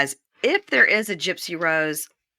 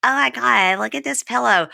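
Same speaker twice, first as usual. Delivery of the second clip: Paris Hilton voice; 6 semitones higher than usual. Retold or imitated. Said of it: "showed it to her husband and goes".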